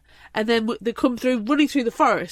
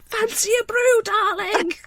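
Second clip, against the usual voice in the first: frantic guessing; 10 semitones higher than usual. Pretend.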